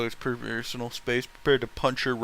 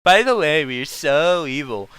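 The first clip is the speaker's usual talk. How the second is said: mocking villains; 2 semitones higher, 6 dB louder than usual. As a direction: parody the cartoon bad guys.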